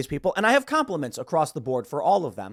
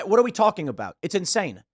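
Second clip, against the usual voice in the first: ordinary delivery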